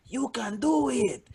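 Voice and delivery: slow, high, drawn-out encouragement; mock encouraging cheer. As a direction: imitate an audience member willing the comic on. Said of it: "almost wants to shout".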